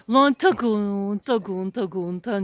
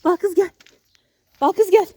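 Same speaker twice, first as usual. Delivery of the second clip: high, loud call to a pet; calling the dog; 8 semitones higher, 6 dB louder than usual. Real, not acted. Owner calls his straying dog, loud high calling. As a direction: call her over loudly, voice pitched up.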